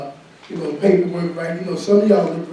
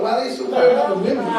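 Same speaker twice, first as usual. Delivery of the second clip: laughing; 5 semitones higher, faster than usual. Real, not acted. Laughing at own joke.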